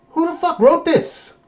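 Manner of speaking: very high, exasperated; mock the writers in disbelief; incredulous. Real, not acted. Fan ranting at the writers, high disbelief. Mocking bad writing.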